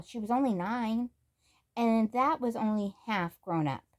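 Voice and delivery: ordinary delivery, nothing marked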